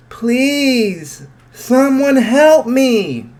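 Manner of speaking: very high, loud, slow wailing plea; panicked crying cry for help; crying scream. Acted. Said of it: "begins to cry his eyes out and scream".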